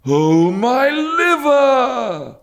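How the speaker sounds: very high, loud, very slow howl; anguished cry. A narrator's reading. Old dealer throwing the jacket on the shelf, howling. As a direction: howl it out in mock agony. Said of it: "cried the old man".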